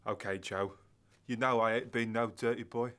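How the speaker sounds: put-on [name] trying a Cockney accent on the line; a clumsy East End accent. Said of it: "That accent".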